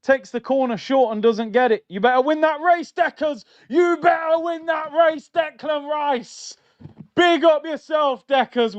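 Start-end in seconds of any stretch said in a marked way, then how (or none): 1.9-8.8 excited urging. Real, not acted. Urging a player on screen.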